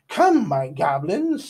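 loud slow commanding call; shouted. A narrator's reading. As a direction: summon the army with a shout. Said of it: "Salamandra shouted".

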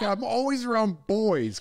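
high, slow whiny mimic; mocking whine. Husband mimicking his wife's complaint, high and slow. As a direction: stretch it out like a whining wife.

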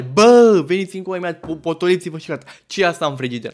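loud opening shout, then easing; yelled summons settling into scolding; retold yell. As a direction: re-enact bawling them over to the mess. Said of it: "am țipat la ei".

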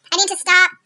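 ordinary talk

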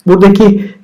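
ordinary talk

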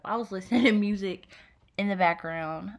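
laughing through the words; giggling while you talk. Real, not acted. Laughs while telling about music.

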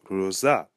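"Rosa" with a strong, stressed final ZA; stress on final syllable. Shown as a demonstration. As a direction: hit the za at the end of "Rosa". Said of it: "the final syllable, uh, za, has the emphasis".

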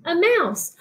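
surprised reveal. Acted. Repeat of the reveal.

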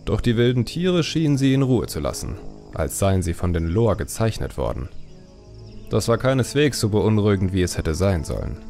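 ordinary delivery, nothing marked

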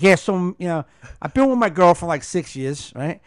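loud, fast Jersey guy; Jersey accent. Retold or imitated. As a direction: play up the Jersey East Coast twang. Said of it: "he's like".

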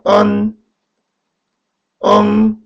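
언 and 엉 held high and level; teacher models 언 and 엉 on one high pitch; pronunciation demo. Shown as a demonstration. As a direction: sustain 언, then 엉, as isolated finals. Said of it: "이는 어떻게 발음하죠?".

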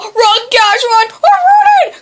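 urgent high shouting; frantic cries, climbing in pitch; panicked yelling. Real, not acted. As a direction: yell as if being chased. Fleeing danger in game.

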